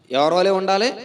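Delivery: ordinary talk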